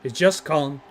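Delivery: measured, hushed introduction with long pauses; mock-solemn announcer. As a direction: introduce the fighter with reverent gravity.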